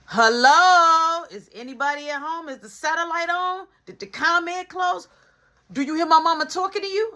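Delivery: mock them for not answering; mother scolding her silent kids with sarcasm; sassy scolding mom.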